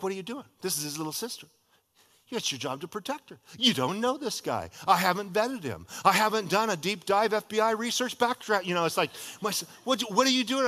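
mock-outraged big brother; upset brother. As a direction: push back hard on the parents. Troubled son confronting the parents.

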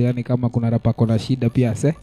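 ordinary delivery

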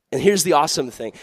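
usual speaking voice

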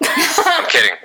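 high giggling laugh after the joke; laughing off a teasing joke. Real, not acted.

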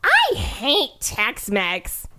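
drawn-out, high imitation of a complainer; mocking overheard lady. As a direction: stretch it out as a mocking quote. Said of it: "I overhear this lady go".

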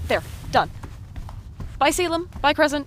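loud, high rushed farewell; hurried shouted goodbye. Acted. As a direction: call it on the way out.

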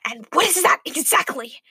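rough, menacing demand; snarling. A narrator's reading. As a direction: snarl it through the teeth. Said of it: "snarled Rachel".

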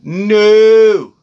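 a loud, firm no; loud emphatic no. Real, not acted. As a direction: shut it down loud. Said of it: "firm emphatic refusal".

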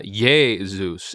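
"Jesus" as YAY-zoos; Greek pronunciation. Shown as a demonstration. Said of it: "in the original Greek language".

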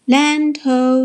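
high, loud lookout cry; yelling. A narrator's reading. Pete from the crow's nest sighting shore, yelling. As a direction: holler it long from the mast. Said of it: "yelled Pete from the crow's nest".

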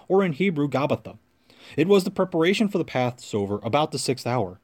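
ordinary talk

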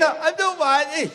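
high, slow, drawn-out reluctant whine; whiny refusal. Retold or imitated. Reluctant mate whining to the ringleader, a high slow whine. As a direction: play the mate who doesn't want to drive.

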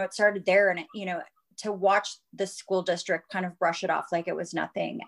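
ordinary talk, nothing marked

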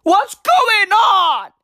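high, drawn-out mock bewilderment; imitated amazement. Viewer reacting to booze looters running, exaggerated astonishment. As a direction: stretch it out in exaggerated disbelief. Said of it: "I was like".

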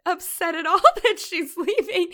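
high and loud, laughing through it; laughing quote. Retold or imitated. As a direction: laugh out the words in disbelief. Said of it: "And I was like".

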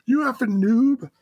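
high-pitched gamer taunt; mock trash talk. Husband imitating wife heckling from the couch, mock trash talk. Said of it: "She's talking trash to you".

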